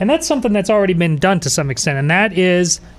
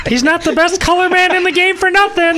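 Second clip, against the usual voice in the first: much higher mock broadcaster line, a bit louder; movie announcer quote; 13 semitones higher, 3 dB louder than usual. Co-host quoting a Major League announcer, mock broadcaster voice. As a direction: ham up a sportscaster's wisecrack. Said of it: "Nice Major League reference".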